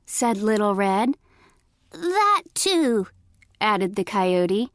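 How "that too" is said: high fake granny voice; high-pitched granny imitation. A narrator's reading. Coyote excusing his voice to Little Red, fake granny voice. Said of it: "in a high-pitched voice".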